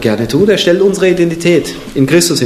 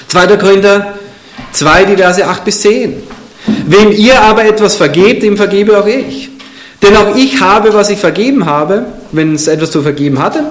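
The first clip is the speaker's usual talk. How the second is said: solemn, raised reading of a Bible verse; scripture reading; 5 semitones higher than usual. Acted. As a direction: proclaim the verse to the congregation. Reads scripture aloud.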